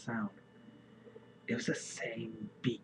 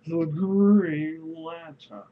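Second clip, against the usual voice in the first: slow, loud, higher hero announcement; dramatic announcer; 5 semitones higher, 6 dB louder, much slower than usual. Pretend. Gamer hamming up the hero's name, slow and loud. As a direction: drag it out like a trailer voice.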